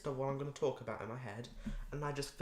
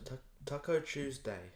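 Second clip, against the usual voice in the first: ordinary delivery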